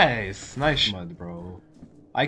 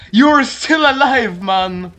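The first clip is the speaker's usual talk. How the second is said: 1 surprised shout; 6 semitones higher, 4 dB louder, slower than usual. Real, not acted. Surprised someone survived.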